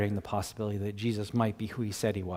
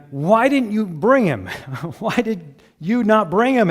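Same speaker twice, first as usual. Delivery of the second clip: indignant demand; 7 semitones higher, 6 dB louder than usual. Acted. Pharisees scolding officers, read aloud.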